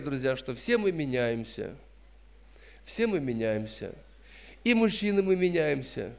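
normal talking voice

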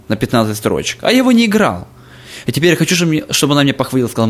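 ordinary delivery

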